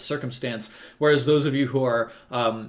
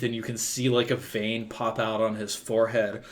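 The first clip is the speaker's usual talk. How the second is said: usual speaking voice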